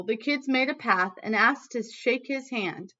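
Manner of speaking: usual speaking voice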